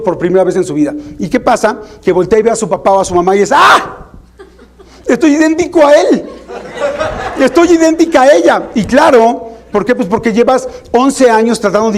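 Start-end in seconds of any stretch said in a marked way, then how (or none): 3.5-8.7 high-pitched shocked cry with a laugh; a teen suddenly seeing they're a copy of their dad; horrified realization. Acted. Teen sees self as parent.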